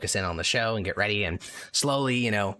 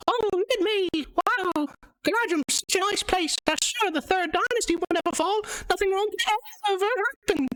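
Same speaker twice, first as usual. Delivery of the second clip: a naive sailor puppet cheerfully tempting fate. Acted. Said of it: "it says".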